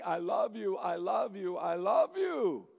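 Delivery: usual speaking voice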